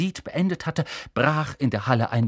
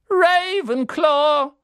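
loud, high, slow hat's cry; calling out; 13 semitones higher, 6 dB louder, much slower than usual. A narrator's reading. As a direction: proclaim the house to the hall. Hat announces house. (laut verkündet vom Hut)

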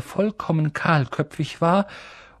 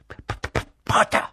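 stammering; faster than usual. A narrator's reading. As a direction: get stuck nervously on the first sound. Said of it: "stammelte Professor Quirrell".

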